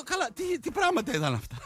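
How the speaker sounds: retold disbelief. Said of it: "Και λέω".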